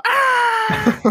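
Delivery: sudden high, loud scream of mock horror; horrified scream. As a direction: shriek like the worst nightmare just appeared. Imagining Pennywise as pregnant girl.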